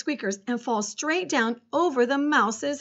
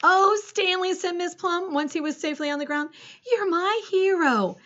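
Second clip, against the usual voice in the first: adoring; 7 semitones higher than usual. A narrator's reading. Teacher praising her rescuer.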